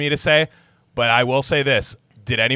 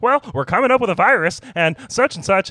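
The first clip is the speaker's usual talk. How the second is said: high-pitched, faster mock tech-billionaire voice; Bill Gates; 7 semitones higher, 4 dB louder, faster than usual. Comic podcaster voicing a billionaire admitting a virus mishap, high-pitched impression. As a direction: put on a higher voice for a mock billionaire confession. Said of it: "Bill Gates is like".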